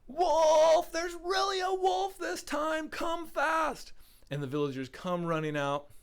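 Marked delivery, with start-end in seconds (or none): 0.1-3.9 high, drawn-out full-volume alarm yell. Acted. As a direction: yell for help as loud as you can. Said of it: "He yells as loud as he can".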